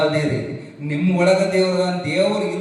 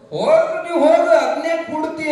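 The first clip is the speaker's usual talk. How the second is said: high, loud, fast jeer; mocking rich man; 11 semitones higher, 4 dB louder, faster than usual. Greedy rich man jeers at the poor farmer, loud high mockery.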